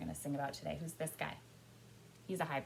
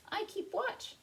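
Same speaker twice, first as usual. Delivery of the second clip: high-pitched story crow; crow character; slower than usual. A narrator's reading. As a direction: speak as the watchful crow, high.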